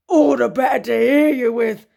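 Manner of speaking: a growly-free, loud wolf reply. Acted.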